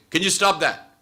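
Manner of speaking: irritated snap. Retold or imitated. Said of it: "Then you'll end up saying".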